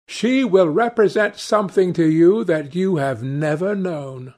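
put-on eager young Dorian. A narrator's reading. Dorian eagerly urges a theatre visit.

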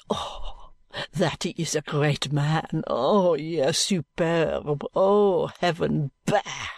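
mock admiration. Mocking praise.

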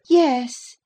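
put-on high, eager girlish voice; a lively young lady pleading fondly. A narrator's reading. Catherine voiced.

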